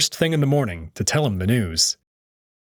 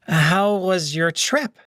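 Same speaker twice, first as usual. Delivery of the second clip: high, hurried eager agreement; nervous Cassius; 8 semitones higher, much slower than usual. Acted. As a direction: jump in to agree, flustered. Cassius asks Cato.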